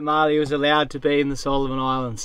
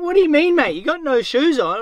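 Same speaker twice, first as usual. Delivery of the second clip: incredulous challenge; 9 semitones higher than usual. Retold or imitated. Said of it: "I'm like".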